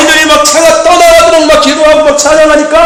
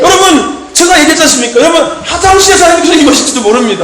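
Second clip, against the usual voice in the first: fiery preaching; 3 semitones higher than usual. Acted. Pastor urging the congregation to stay ready, fiery preaching. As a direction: preach it hard and wait for the amens.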